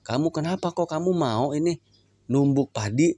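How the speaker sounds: lightly voiced prophet questioning the mortar; wondering question. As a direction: ask the moving mortar in surprise. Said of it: "ditanya makanya Rasul".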